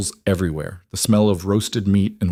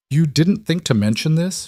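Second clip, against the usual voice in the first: shocked; 8 semitones higher than usual. A narrator's reading. Alara confronts Darian in shock.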